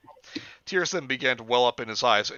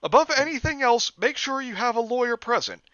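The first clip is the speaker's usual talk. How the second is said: calling out; 13 semitones higher, 7 dB louder than usual. Acted. Jeff calls after Lou.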